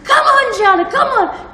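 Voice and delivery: high, loud shout; encouraging yell. Retold or imitated. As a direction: cheer her on, full voice. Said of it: "helped me by yelling at me".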